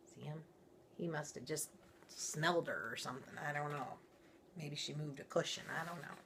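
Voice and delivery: hushed chatty aside; quiet aside. Real, not acted.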